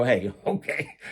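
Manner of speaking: laughing as he retells his reply; a quick laugh into 'okay'; amused agreement. Retold or imitated. Inmate answering Toothpick's warning, amused and laughing.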